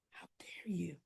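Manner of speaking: How dare you? in a voiceless hissed whisper; whispered accusation. Retold or imitated. Son to father over the affair: whispered accusation. Martin confronting his father.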